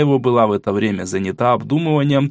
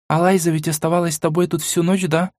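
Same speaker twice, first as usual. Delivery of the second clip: put-on girl voice; 6 semitones higher than usual. A narrator's reading. Mila asks.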